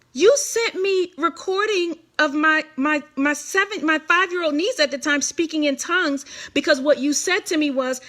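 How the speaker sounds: as if she can't believe the nerve; indignant disbelief. Retold or imitated. Confronting the Baltimore pastor over her lie, incredulous.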